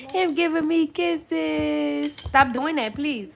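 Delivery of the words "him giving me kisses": put-on drawn-out slow baby talk; slow cooing. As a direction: gush at the baby slowly. Cooing repeat.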